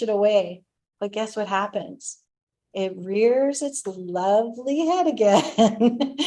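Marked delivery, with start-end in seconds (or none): 2.7-6.3 slow, laughing irony; wry chuckle through the words; laughing at lovely. Real, not acted. Ironic laugh.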